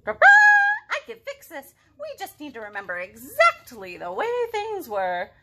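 lightly voiced know-it-all fixer; a hint of a confident elephant. Elephant character voice.

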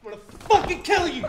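high, loud, enraged shout; furious threat. Acted. Man threatening whoever gave him away, furious shout. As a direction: roar the threat at the betrayer.

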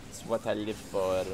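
hammed-up bad French accent, a bit low; mock French accent; horrible French accent. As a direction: put on a deliberately terrible French accent. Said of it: "In my horrible French accent".

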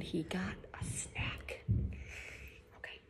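breathy whisper, voice dropped away; whispered aside. Real, not acted. Whispered aside about son.

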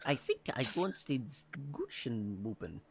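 soft, low mock-German; bad German accent. Shown as a demonstration. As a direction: fumble through a put-on German accent. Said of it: "That's my German".

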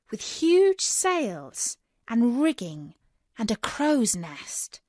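usual speaking voice

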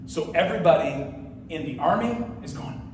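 normal talking voice

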